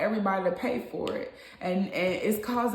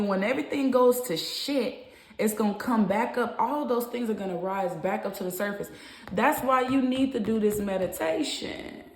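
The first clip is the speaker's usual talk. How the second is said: usual speaking voice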